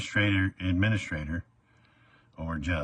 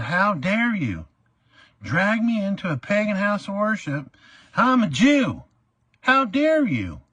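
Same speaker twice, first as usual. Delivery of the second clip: high, loud indignant tirade; retold courtroom rant at full force; furious outburst; 13 semitones higher, 5 dB louder than usual. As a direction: blast him for dragging you into a pagan house. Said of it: "I went off".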